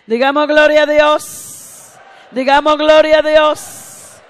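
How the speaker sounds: loud, high, drawn-out shout; rally the congregation to shout along; slow, ringing preacher's cry; rousing praise call. Real, not acted.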